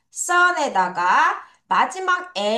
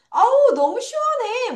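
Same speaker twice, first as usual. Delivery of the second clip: much higher exclamation of feeling cool; acted cool relief; 11 semitones higher than usual. Teacher cues learners to say it's cool, high acted exclamation. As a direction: exclaim as if hit by a cool breeze.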